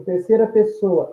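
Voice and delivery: ordinary talk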